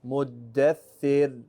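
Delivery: Muddathir drawn out slowly, the R barely sounded; very slow Muddathir with a faint R; light Ra. Shown as a demonstration. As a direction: stretch Muddathir and let the R almost vanish. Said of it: "barely hear it".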